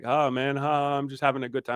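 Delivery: high-pitched imitation, carefree chuckling tone; oblivious partygoer. Imitating the groper shrugging off a complaint, carefree. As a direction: play a tipsy guy excusing himself. Acting the groper excusing himself.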